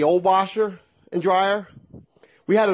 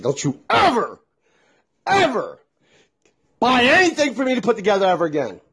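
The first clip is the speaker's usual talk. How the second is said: angry outburst; 6 semitones higher than usual. Retold or imitated. Angry outburst at his wife.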